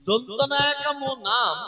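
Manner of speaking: দন্ত নয় আকাম নাম slow and clear like dictation; deliberate teacher spelling; slow spelled out. Shown as a demonstration. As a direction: spell it out slowly, each part weighed. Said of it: "ভালো করে বুঝুন".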